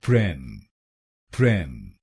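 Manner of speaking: प्रेम said low and falling, clearly apart; teacher isolating प्रेम; syllable demo. Shown as a demonstration. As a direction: drop the pitch and say प्रेम as one clean syllable. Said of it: "पीआरईएम को बोलिए".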